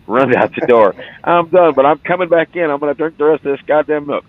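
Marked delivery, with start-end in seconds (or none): 1.2-4.2 high, louder stubborn vow; defiant boast. Retold or imitated. Man running back in vowing to finish the milk, loud defiant. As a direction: insist you will finish the milk. Quoting determined friend.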